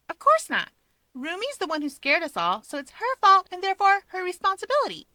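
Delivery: put-on high bright girl voice; young woman voice. A narrator's reading. Rika's voice, high.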